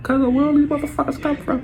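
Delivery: high, astonished question; shocked. Retold or imitated. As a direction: squeak it out in disbelief. Shocked at strangers in house.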